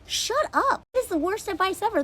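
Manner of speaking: shoots up high in disbelief; high outraged shut up. Real, not acted. Reacting to bad dating advice, outraged high shut up. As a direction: scoff at the terrible advice, way up high. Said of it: "reacting to advice".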